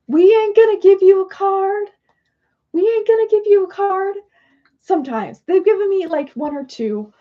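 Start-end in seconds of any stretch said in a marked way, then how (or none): 0.1-4.2 high, loud sassy spirit voice; high squeaky taunting spirit voice; voicing Spirit. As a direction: play the cheeky spirits refusing her. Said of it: "And they're like".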